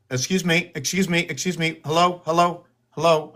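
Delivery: high sing-out to a cat; coaxing for attention. Real, not acted. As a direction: get a pet's attention, high and unforced. Trying to get the cat's attention.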